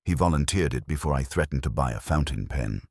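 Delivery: plain reading voice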